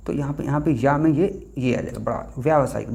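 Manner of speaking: normal talking voice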